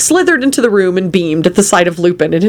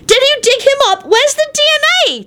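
very high, frantic shrieked questions. A narrator's reading. Timothy demanding proof from Quentin, shrieking. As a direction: shriek it, voice right at the top. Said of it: "Timothy shrieked".

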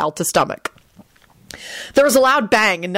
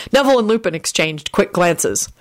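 ordinary delivery, nothing marked

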